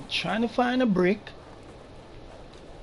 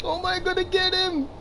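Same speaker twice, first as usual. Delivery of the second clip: high laughing panic; 12 semitones higher than usual. Real, not acted.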